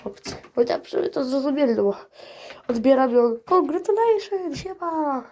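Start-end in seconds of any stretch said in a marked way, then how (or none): 3.5-5.3 high, slow, stretched mock greeting; caller's shouted congratulations. Retelling the caller's greeting on the phone, high and stretched. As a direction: sing it out high like the overeager caller. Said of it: "jak ktoś na mnie krzyczy".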